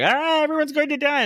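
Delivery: hammed-up Doctor crying doom; mock panicked outburst. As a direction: burst in yelling doom like a madman. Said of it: "blazes in".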